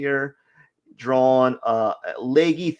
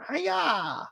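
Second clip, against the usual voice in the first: mock stabbing cry with a laugh; karate yell; 12 semitones higher, 7 dB quieter than usual. Voicing the stabbing figure with a laughing "Hi-ya". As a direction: yelp it like a clumsy fighter. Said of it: "practicing his stabbing".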